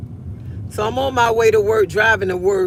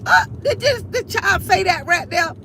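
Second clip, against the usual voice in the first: high, startled disbelief; shocked; 9 semitones higher than usual. Retold or imitated. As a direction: act totally scandalized. Mock shocked reaction.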